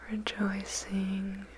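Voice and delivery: ordinary delivery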